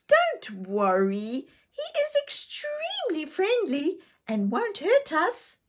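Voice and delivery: put-on very high, bright small child reassuring; little girl voice. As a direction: speak as a cheerful little sister.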